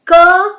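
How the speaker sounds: k as a loud, high "kuh"; isolated sound demo. Shown as a demonstration. Teacher listing sounds for learners, "k" as a punched "kuh". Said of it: "some of the words or the sounds".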